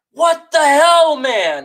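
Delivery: real frustrated cry; angry outburst. Real, not acted. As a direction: let it burst out, incredulous. Angry fan ranting about trade.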